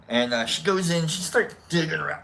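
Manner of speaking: ordinary talk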